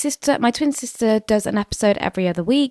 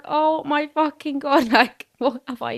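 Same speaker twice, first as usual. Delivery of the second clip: 1 laughing panic; 7 semitones higher, 5 dB louder than usual. Retold or imitated. Own panicked reaction retold, laughing.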